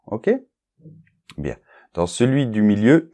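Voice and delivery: usual speaking voice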